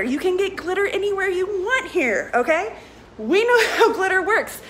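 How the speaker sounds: high laughing delivery. Real, not acted. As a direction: laugh through the words. Laughing while talking.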